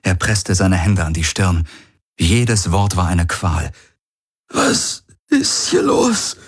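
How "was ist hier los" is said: hoch, langsam, gequält gefragt. A narrator's reading. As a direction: voller Schmerz fragen. Galen unter Schmerzen. (gequälte Frage)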